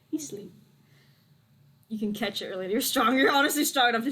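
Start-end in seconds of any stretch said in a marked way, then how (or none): 0.1-0.5 soft, whispery hush; hushed aside. Pretend. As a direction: say it very softly, almost whispered. Hushed aside about sleeping character.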